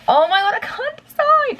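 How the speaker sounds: high, loud indecisive squeal; exasperated squeal. Real, not acted. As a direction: wail it in mock despair. Torn over dyeing hair.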